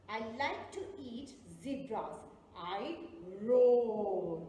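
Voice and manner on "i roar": a loud, low roared word; playful loud lion roar for kids. Acted. Riddle about a lion.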